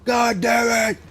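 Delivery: sudden high yelled outburst; hammed-up angry shout; mock rage shout. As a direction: blow up in fake fury. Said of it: "and then I'm like".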